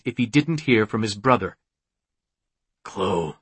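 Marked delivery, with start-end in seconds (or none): 2.8-3.3 low-pitched, ominous call of her name; strange demon voice. A narrator's reading. Cloaked demon calls Chloe from behind, low strange voice. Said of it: "at the strange voice".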